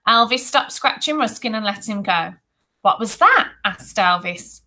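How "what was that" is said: high, louder startled question; bully's pitch-raised query; sudden curiosity. A narrator's reading. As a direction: pounce on the question in surprise. Bully spots fallen treasure.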